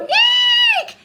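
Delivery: high squeaky eek; high scared squeal. Shown as a demonstration. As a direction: squeal at the spider. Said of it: "Do you go".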